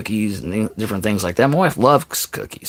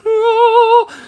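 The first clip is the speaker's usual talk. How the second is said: joyful gasp; 6 dB louder than usual. Retold or imitated. Delight at finding the case.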